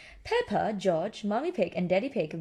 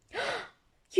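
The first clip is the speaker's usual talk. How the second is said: surprised gasp; 14 semitones higher, 6 dB quieter than usual. Acted.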